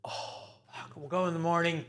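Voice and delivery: resigned, hushed parental groan; weary dad. Retold or imitated. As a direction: sigh it out under your breath, caught out. Dad's weary reaction, retold.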